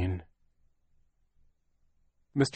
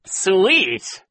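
high, loud mocking villain; Count Olaf sneer; 11 semitones higher, 9 dB louder than usual. A narrator's reading. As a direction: sneer with a horrible smile. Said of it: "repeated with a horrible smile".

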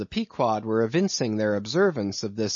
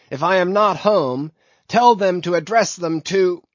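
higher, louder hail across the water; 6 semitones higher, 6 dB louder than usual. A narrator's reading. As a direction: call out to a passing ship, voice raised. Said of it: "he loudly hailed".

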